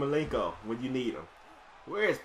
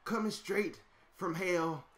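hype announcer; 2 semitones higher, 4 dB quieter than usual. Acted. Announcing the opponent's return from hell, booming and dramatic. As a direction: sell the villain's arrival to the crowd.

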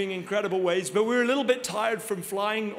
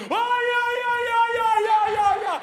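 much higher excited yeahs, building to a laughing shout; all excited; 13 semitones higher, 5 dB louder than usual. Retold or imitated. Grandfather-to-be hearing baby news, excited high yelling. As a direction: bounce around the room yelling yeah with joy. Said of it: "all excited".

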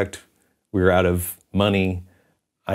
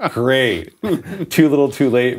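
higher, through real laughter; laughing ruefully; 5 semitones higher, 4 dB louder, slower than usual. Real, not acted. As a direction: laugh at the bitter irony as you speak. Laughs at the ironic timing.

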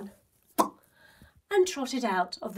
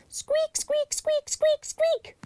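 very high squeaky mouse noises; high-pitched mouse squeaks; slower than usual. A narrator's reading.

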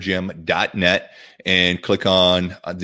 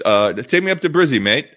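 a playful, hammed-up Australian accent, pitched higher; mock Aussie accent; 6 semitones higher, 3 dB louder than usual. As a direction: try out a cheeky Aussie twang on "take me up to Brizzy, mate". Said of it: "how's that sound?".

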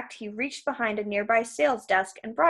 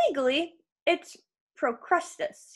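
slow, raised, grudging confession; reluctant admission; 6 semitones higher, slower than usual. A narrator's reading. Krusty giving his real name, reluctantly admitting it. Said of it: "he admitted".

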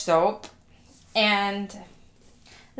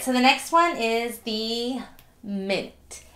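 ordinary delivery

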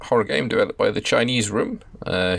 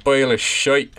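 loud, higher imitation of a Scottish accent; mock Scottish accent; 6 semitones higher, 5 dB louder, slower than usual. Streamer gleefully echoing the rig worker's line, mock Scottish accent. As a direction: parrot it back in a punched-up Scottish accent.

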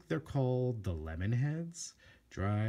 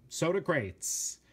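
normal talking voice

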